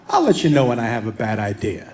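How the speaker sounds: a deep, self-satisfied brag; cocky. Retold or imitated. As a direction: say it like you're far too sure of yourself. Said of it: "maybe got a little too cocky".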